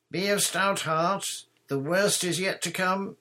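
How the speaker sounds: high, loud call of encouragement; yell. A narrator's reading. Knight running through pictures to his followers, yelling. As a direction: call ahead like a heroic knight. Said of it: "yelled the knight".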